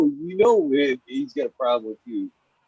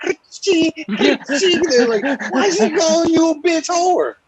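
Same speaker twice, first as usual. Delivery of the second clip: much higher, louder squeaky gossip; high louder mock squirrel chatter; 7 dB louder than usual. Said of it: "I'm all mimicking".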